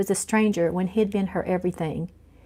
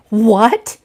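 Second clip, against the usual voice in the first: loud shocked rising what; shocked outrage at the news; indignant loud what; outraged what; 3 semitones higher, 7 dB louder than usual. Acted. Face went purple, outraged.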